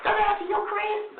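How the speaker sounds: put-on high girly voice, up in falsetto; girl voice. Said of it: "He hit the little, the girl voice".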